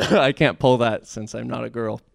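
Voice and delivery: normal talking voice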